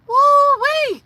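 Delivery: loud, very high "woo-wee"; overwhelmed whoop. Real, not acted. Overwhelmed by a viewer's story, astonished whoop. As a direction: whoop it out in amazement.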